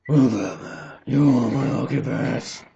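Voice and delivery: usual speaking voice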